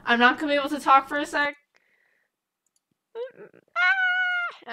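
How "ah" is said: shout. Real, not acted. Mic cutting out.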